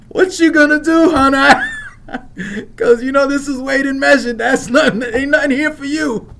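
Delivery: loud, high, laughing taunt; laughing through words. Real, not acted. Mocking the panicked guard, loud laughing taunt.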